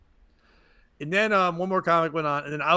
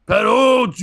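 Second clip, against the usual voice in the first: loud slurred call of a name; drunk shout; 6 semitones higher, 9 dB louder than usual. Retold or imitated. Drunk guy in the crowd calling out to the comic, loud. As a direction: yell it like a drunk heckler.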